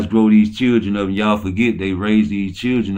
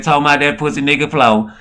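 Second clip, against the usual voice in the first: ordinary delivery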